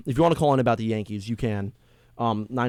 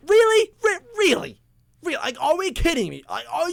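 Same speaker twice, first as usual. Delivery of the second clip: incredulous; 13 semitones higher than usual. Real, not acted. Exasperated reaction to injury news.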